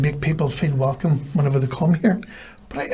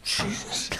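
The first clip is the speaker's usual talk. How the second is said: a breathy, near-whispered mock exclamation of disbelief; under the breath, like a stranger taken aback; muttered Jesus; 5 semitones higher, 10 dB quieter than usual. English bar stranger startled by friendly barman, muttered under breath. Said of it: "And they would go".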